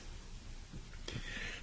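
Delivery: quiet dry cough; cough, throat irritated. Real, not acted. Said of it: "excuse me".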